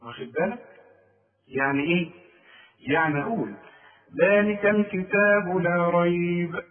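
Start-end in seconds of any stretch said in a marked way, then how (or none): 4.2-6.6 slow, chanted phrase stopping on ريب; pause on ريب. Shown as a demonstration. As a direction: recite it slowly and come to a full stop on ريب. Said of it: "انا وقفت على ريب".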